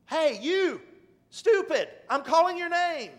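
loud, high insulting bark; imagined rude cashier yelling; how he heard the cashier calling him, a loud snapping call. Retold or imitated. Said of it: "That's how I took it".

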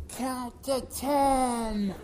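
drawn out like a voice from a thousand miles off; distant calm call. Retold or imitated. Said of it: "That was from Carol".

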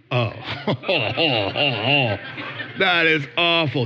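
laughing oh, then that is awful; sarcastic horror, laughing through it; mock horror with a laugh. Preacher mocking critics' horror at repentance, laughing.

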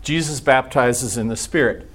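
ordinary talk, nothing marked

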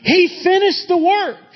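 high, impassioned pulpit delivery; fired-up preacher, pitch soaring; excited preaching. Real, not acted. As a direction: build to a ringing proclamation, scoffing at human achievements. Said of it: "It feels like I'm getting excited".

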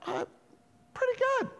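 tiny, very high hesitant answer; puzzled reply. Retold or imitated. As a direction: squeak it out, unsure. Woman puzzled by his odd question.

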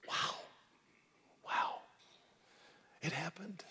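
breathy whispered awe; hushed whisper of certainty. Real, not acted. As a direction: lean in and whisper it. Whispered affirmation after pause.

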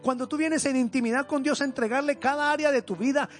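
loud, fervent sermon climax; preaching at full force. Acted. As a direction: preach it out with rising fire.

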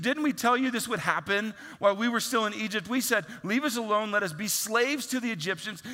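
a hammed-up griping crowd; whiny complaint. Israelites complaining to Moses.

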